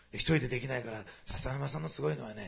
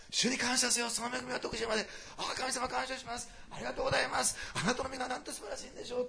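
high, bright shout of thanks; joyful praise; 10 semitones higher than usual. Pretend. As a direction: sing out thanks with joy. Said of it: "喜びの声を上げながら". (喜びにあふれた賛美の声)

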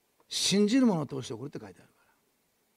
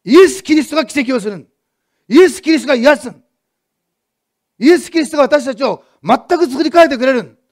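fervent preaching; 7 semitones higher, 12 dB louder than usual. Acted. Pastor proclaiming to the congregation, fervent full-force preaching. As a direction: drive the point home to the congregation.

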